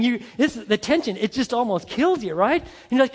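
normal talking voice

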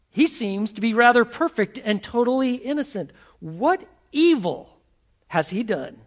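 high, loud, exasperated Pilate; Pilate pleading. Acted. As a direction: plead the man's innocence to the crowd. Preacher voicing Pilate pleading.

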